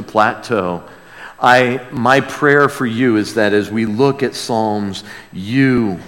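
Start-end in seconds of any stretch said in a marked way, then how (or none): none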